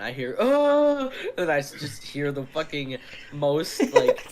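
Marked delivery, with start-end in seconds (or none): 0.4-1.1 loud, high-pitched "oh"; imitated straining groan. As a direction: mimic a loud groan from the stall. Imitates groan from toilet stall.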